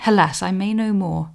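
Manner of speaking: ordinary delivery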